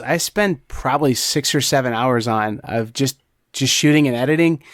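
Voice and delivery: normal talking voice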